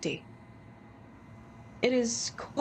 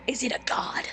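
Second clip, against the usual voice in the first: gasping whisper; awed whisper; 3 semitones higher than usual. Acted. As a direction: breathe it in awe.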